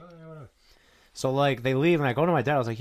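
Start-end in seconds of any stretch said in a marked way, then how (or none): 0.0-0.5 soft, higher mock mumble; mimicked distant reply. Dad answering the cops out of earshot, soft mimicry. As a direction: imitate a half-heard answer, very softly.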